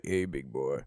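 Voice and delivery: low, soft put-on voice; imitating voicemail greeting. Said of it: "It'd be like".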